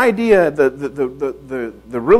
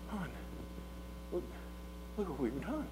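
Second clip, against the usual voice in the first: very soft, near-whispered wonder; hushed tender awe; 24 dB quieter than usual. Retold or imitated. New father shows first child to his wife, hushed. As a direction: murmur it over a sleeping baby.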